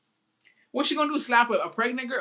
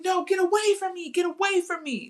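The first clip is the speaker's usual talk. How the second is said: fake distress; 10 semitones higher than usual. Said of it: "I'm like".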